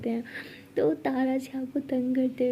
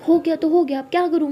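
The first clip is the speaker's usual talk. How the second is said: mildly shouting, higher and louder; acted quarrel, voice raised a little; 5 semitones higher, 6 dB louder than usual. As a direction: snap back in a mild argument, voice up. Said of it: "पहले चिल्लाना बंद करो".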